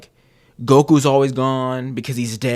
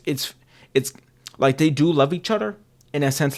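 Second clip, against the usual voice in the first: usual speaking voice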